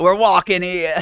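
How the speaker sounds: a hint of a brash New York accent, punching were; quoting the New York street guy, lightly accented; New Yorker accent. Retelling the classic New York street line, mild New York accent. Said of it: "a guy walking in New York and says".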